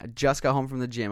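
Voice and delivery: normal talking voice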